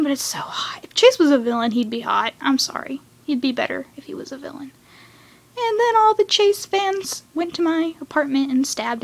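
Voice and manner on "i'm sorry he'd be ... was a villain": usual speaking voice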